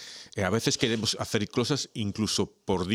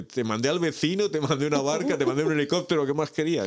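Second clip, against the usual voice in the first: God telling the drowned man what he already sent, higher and rising in volume; God answering; 8 semitones higher than usual. Acted. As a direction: list the rescues as an exasperated God, pitched up.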